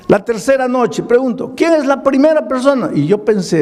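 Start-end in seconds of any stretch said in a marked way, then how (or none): 1.6-2.9 calling out. Retold or imitated. Pastor's altar call.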